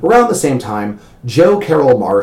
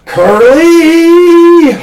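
enraged cry, fist raised to the sky; furious yell at the heavens; 9 dB louder than usual. Retold or imitated. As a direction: shout it skyward, high and loud, like a villain cursing fate. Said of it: "shake his fist at the heavens and yell".

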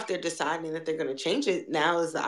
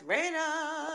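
high sing-song; 9 semitones higher, 9 dB quieter than usual. Acted. Repeated plea, high and soft.

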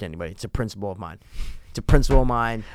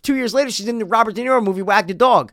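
higher, louder, worked-up telling; excited gossip; 10 semitones higher, 5 dB louder than usual. Real, not acted. Telling his friend the Anne Heche secret, excited. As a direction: spill the gossip like it's huge news.

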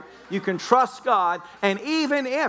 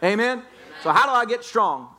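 ordinary talk

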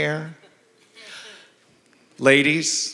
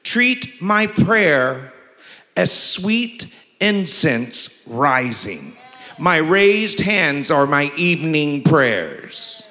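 very slow, lifted pulpit reading; fervent preacher read; scripture reading; 3 semitones higher, much slower than usual. Acted. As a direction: linger on each word like incense rising.

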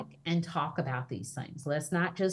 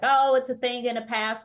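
mock dismissal; 6 semitones higher, 6 dB louder than usual. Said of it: "not just feel like".

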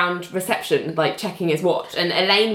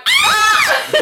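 very high, loud comic shriek; hammed-up scream of alarm; mock scream; 6 dB louder than usual. Retells John's alarmed scream.

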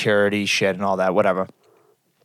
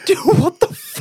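shocked disbelief. Retold or imitated. Retold shock at the date.

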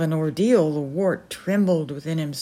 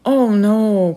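a much louder, anguished oh no; cry of agony; 4 semitones higher, 9 dB louder than usual. Acted. The crazy colonel pleading for the boy, crying out loud. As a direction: burst out in horror. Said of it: "cried the crazy colonel in an agony".

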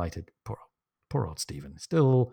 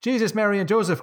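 higher-pitched Irish exclamation; Irish accent; 9 semitones higher than usual. Acted. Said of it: "do it in the Irish accent".

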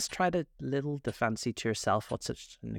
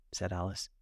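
ordinary delivery

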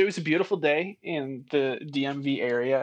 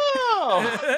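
higher, loud startled "Oh!"; startled shout; 5 dB louder than usual. Retold or imitated. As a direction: cry out again as if caught. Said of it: "and I was like".

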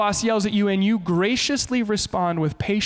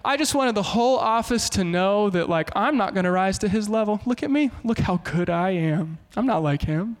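preen like the office saint; lightly hammed holier-than-thou worker; mock showing off; 3 semitones higher than usual. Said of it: "avoid making a show of it".

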